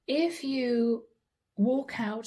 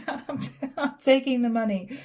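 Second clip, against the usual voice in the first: cracking up mid-line; giggling while voicing Sag; laughing through it; 3 semitones higher, 4 dB louder than usual. Real, not acted. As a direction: laugh breaks the words, then louder. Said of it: "I'm sorry, I'm laughing".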